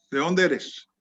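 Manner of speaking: ordinary delivery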